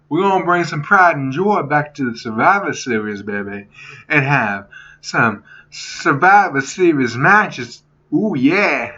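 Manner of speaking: rough amateur take on a wrestler's promo voice; Dusty Rhodes. Acted. Fan retrying his hype line for Survivor Series, badly imitating Dusty Rhodes. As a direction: do a bad wrestling-legend impression, milking the catchphrases.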